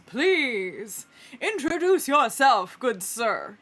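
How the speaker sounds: loud, slow ringmaster invitation; showy announcer. Acted. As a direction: project it grandly with stretched words. Groundhog announcer addresses the moose.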